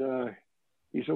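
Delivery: ordinary delivery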